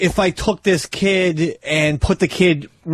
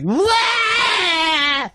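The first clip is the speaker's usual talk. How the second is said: put-on crying kid. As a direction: wail like a bratty toddler. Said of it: "this kid's like".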